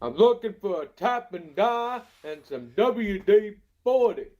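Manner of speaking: high, loud cartoon Texan; Hank Hill voice. Guy on a clip yelling as Hank Hill. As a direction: yell it like Hank Hill at the hardware store. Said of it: "that's his Hank Hill".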